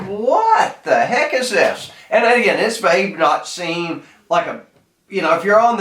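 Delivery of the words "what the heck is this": drawn-out, higher-pitched "what the heck"; retold incredulous reaction; baffled disbelief. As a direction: stretch it out, stunned by what you saw. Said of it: "I was like".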